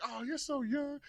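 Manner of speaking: mock gushing. Everybody else flattering him, high gushing voice. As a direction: gush like a fawning friend.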